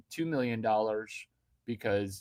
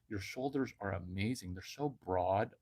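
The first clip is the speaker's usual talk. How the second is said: hushed; 5 semitones lower, 9 dB quieter than usual. Acted. Dreamy compliment, repeated softer.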